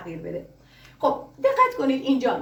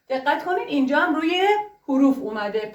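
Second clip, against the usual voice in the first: ordinary talk, nothing marked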